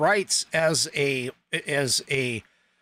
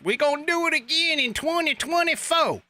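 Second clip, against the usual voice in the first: mock Kamala doing a Southern accent; high mocking Southern accent. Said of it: "tried to do a Southern accent".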